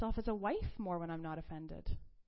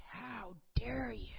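slow, hissed mock outrage; retold outrage; slower than usual. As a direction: draw it out, acting out being mad. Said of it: "I was so mad".

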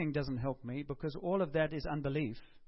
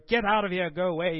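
aggressive reading; 2 semitones higher, 8 dB louder than usual. Retold or imitated. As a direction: bark it out like an aggressive reader. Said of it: "Bill read it in a very aggressive way".

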